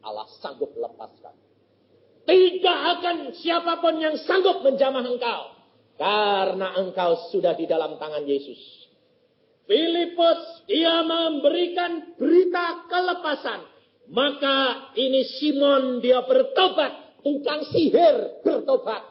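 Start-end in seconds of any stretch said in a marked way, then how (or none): 2.2-5.4 much higher, louder sermon cadence; full-force preaching. Acted. Preacher urging the congregation, loud full-force preaching. 9.7-19.0 much higher, louder, slow sermon chant; slow preaching. Acted. Preacher telling of Philip and Simon, slow raised chant.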